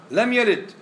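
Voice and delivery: loud, high declaration; emphatic outcry. Real, not acted. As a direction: call out the verse with force. Preacher stresses Quranic verse.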